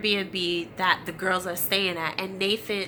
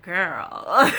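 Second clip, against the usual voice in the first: knowing look; 3 semitones lower, 4 dB louder than usual. Retold or imitated. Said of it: "look at each other like".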